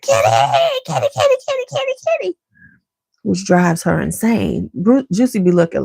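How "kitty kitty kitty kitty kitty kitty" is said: put-on high squealing toddler call; toddler screaming kitty. Toddler Brooke screaming at the cat, high squeal. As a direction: shriek for the cat like a two-year-old. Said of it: "she screams at it".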